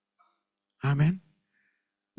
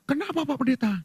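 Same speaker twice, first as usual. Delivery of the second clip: higher, imitated startled question; 9 semitones higher than usual. Tour participant questioning the pastor, mock startled. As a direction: ask it on a raised pitch.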